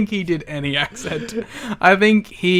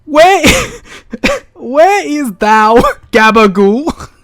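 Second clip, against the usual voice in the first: much higher, louder, slow hammed-up archaic voice; medieval New Yorker; 8 semitones higher, 6 dB louder, much slower than usual. Mocking the wrestler's accent as old-fashioned speech, loud and slow. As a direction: ham up a mock olde-English New Yorker. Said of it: "mystical character".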